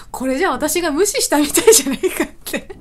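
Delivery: hammed-up lament on the edge of laughter; mock dismay. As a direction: protest to yourself in comic anguish. Acts out her dismay, laughing after.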